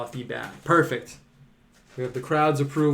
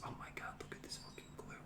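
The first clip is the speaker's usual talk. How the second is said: hushed, whispered exclamation; whispered aside; 23 dB quieter than usual. Real, not acted. Painter muttering to self over spilling glue, whispered. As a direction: whisper it under your breath.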